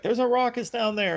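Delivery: squeaky high mock broadcaster voice; mock announcer. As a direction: play the baffled announcer, way up high. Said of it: "Keith Jackson's like".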